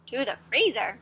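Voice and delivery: ordinary delivery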